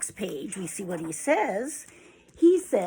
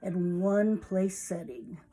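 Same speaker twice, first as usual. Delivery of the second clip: ordinary delivery, nothing marked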